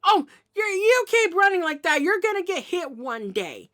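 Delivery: high-pitched, loud mock scolding. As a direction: lecture a reckless cat, voice way up. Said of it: "say something like".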